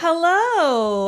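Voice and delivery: loud high bright greeting; excited hello. Real, not acted. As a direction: sing out hello. Greeting on show.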